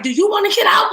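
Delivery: high, loud angry shout; grieving mother lashing out at her mom, shouting; going off. Retold or imitated. Shout it at her high and loud. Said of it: "I'm just going off".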